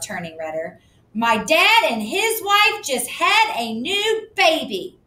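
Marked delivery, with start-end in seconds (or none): 1.2-5.0 put-on high, slow, whiny child's voice; upset girl. A narrator's reading. Susie explaining the new baby, high and whiny. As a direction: drag it out like an upset kid.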